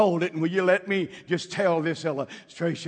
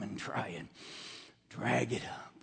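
softer, unvoiced warning; hushed confidential warning; whispered aside; 6 semitones lower, 13 dB quieter than usual. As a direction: lean in and whisper it. Warning about the enemy, dropped low.

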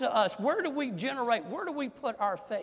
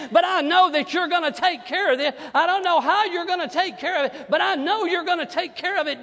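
loud, high, driving sermon climax; full-force preaching; 4 semitones higher, 7 dB louder than usual. Acted. Pastor rallying the congregation, preaching at full force. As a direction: preach it out to the whole church.

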